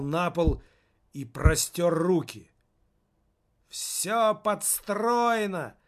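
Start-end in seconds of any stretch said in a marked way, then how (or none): 3.7-5.8 drawn-out, higher mock heckle; shouting from hall. A narrator's reading. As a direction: heckle it slowly from the crowd. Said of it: "выкрикнул кто-то из зала".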